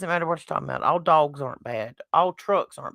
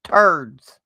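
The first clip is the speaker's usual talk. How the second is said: southern drawl; 2 semitones lower, 7 dB louder than usual. Shown as a demonstration. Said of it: "didn't understand my southern accent".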